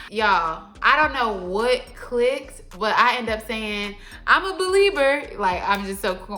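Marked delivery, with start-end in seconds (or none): 4.2-5.2 high, loud, playful retort; corny quip. Retold or imitated. Storyteller answering the church invite, corny and quick. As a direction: deliver it as a corny pun. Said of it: "corny, but quick with it".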